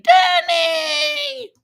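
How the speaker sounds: high, loud cry of a name across a distance; squeaky mock shout; calling out Danny. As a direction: holler his name like you're calling someone far away. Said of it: "Jimmy's saying".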